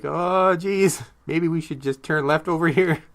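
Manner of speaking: mock sheepish. Imagined movie guys driving past the prank car again, mock sheepish. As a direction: act out an embarrassed, awkward suggestion. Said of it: "all sheepishly".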